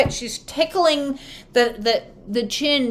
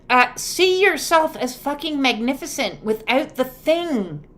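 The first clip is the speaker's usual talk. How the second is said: normal talking voice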